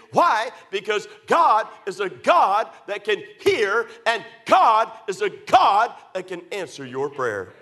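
building plea that swells into a loud cry; preaching at full force. Acted. Preacher begging his congregation, then a loud climactic call. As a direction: plead with them, then ring out the answer.

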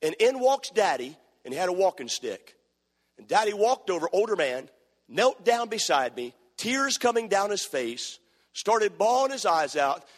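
ordinary delivery

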